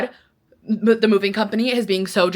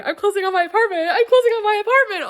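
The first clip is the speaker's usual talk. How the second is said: much higher, thrilled announcing; excited; 12 semitones higher than usual. Real, not acted. Announcing her apartment closing to viewers, thrilled. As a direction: sing it out happily.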